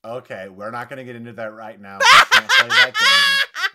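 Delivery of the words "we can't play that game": laughing protest. Real, not acted.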